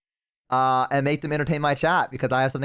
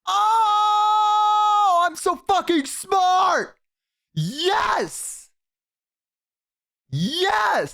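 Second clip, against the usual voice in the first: excited; much slower than usual. Real, not acted. Gloating over a game win.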